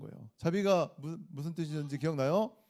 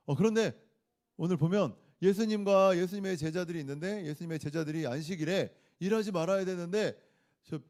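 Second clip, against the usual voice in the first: ordinary talk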